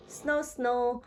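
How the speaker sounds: soft, sleepy snoring sound; spoken snore. Acted. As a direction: snore softly as he drifts off. George falls asleep.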